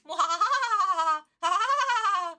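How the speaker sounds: loud, high hammed-up villain laugh; evil cackle. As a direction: throw back your head and cackle like a cartoon villain. Evil laugh after mocking villain.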